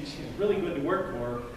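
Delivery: normal talking voice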